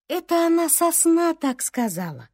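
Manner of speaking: ordinary delivery, nothing marked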